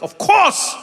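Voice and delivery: high delighted of course; bursting with glee; excited of course. Retold or imitated. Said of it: "I was like".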